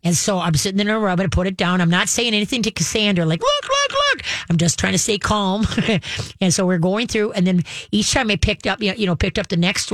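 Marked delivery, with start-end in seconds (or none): 3.4-4.2 squeaky, very high excited "look, look, look"; excited squeal. Retold or imitated. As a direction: squeal it out in sudden disbelief and joy. Excitedly urging daughter to watch.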